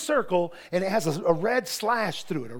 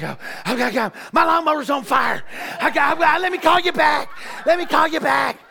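loud, rushed, higher panic as he tries to get off the phone; frantic, laughing through the panic; panicked shout; 4 semitones higher, 5 dB louder than usual. Retold or imitated. Ted shouting that his lawnmower is on fire, panicked.

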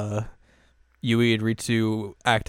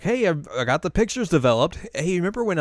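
excited; 8 semitones higher, 4 dB louder, faster than usual. Retold or imitated. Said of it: "she's like".

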